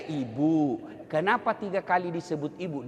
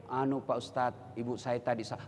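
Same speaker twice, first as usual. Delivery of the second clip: timid student; 6 semitones lower, 7 dB quieter than usual. Retold or imitated. Shy late student.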